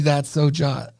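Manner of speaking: catchphrase read. Pretend. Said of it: "T-shirts that say".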